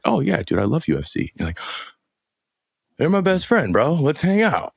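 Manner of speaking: high, overeager buddy voice; mock excited. Telling a friend about meeting stoners, mock excited gush. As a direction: gush like an instant best friend.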